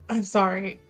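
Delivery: normal talking voice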